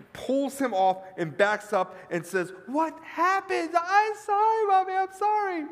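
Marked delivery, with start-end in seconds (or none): 2.7-3.7 high, drawn-out cry of alarm; alarmed mother. Acted. Mother finds the smashed crystal. 3.7-5.7 put-on high, wailing child voice; panicked little boy. Little boy apologizing to mother.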